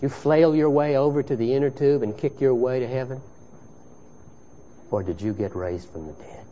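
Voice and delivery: hushed storytelling. Acted. Quiet parable storytelling.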